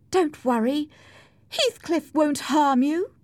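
high-pitched mock reassurance; sarcastic. A narrator's reading. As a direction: taunt him with false comfort, pitched high. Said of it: "cried Cathy sarcastically".

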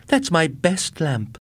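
high, slow, pleading dismay; mourning her best lamp; upset wife. A narrator's reading. Sally dismayed.

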